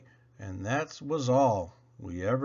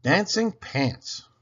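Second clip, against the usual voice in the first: ordinary delivery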